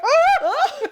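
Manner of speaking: high, loud comic shriek; mock scream. Guest retelling hearing herself mentioned, high mock scream. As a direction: let out a laughing, high-pitched scream. Retells reacting while listening in car.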